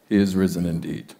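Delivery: mumbled low and soft, going through the motions; flat, routine response. Retold or imitated. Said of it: "It was just the thing you said".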